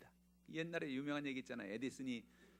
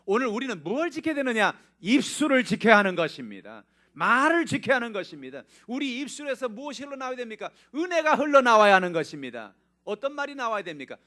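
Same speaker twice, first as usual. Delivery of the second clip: high, loud pulpit exhortation; fiery preaching; 11 semitones higher, 17 dB louder than usual. Acted. Pastor rallies the congregation about speech, loud and high. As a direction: drive it home to the pews.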